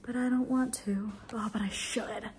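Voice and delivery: whispered reluctance; hushed self-bargaining. Real, not acted. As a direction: whisper the excuse to yourself.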